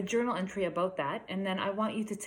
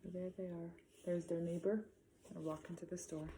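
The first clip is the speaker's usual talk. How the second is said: quiet aside; 12 dB quieter than usual. Acted. Pointing at picture quietly.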